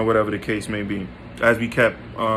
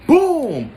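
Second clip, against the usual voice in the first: sudden loud, high "boom"; explosive imitated impact, pitched way up; punch sound effect; 14 semitones higher, 5 dB louder than usual. Storyteller acting out punching his bedroom wall, explosive boom.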